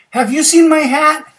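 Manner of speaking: high, loud, eager question; bear asking. Acted. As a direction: call it out high, rising at the end. Bear asks animals for hat.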